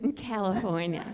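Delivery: hushed imitation of her answer; mimicking audience member. Comic showing how she said California, soft mimicry. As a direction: copy her softly, underplayed. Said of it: "Look how she said it".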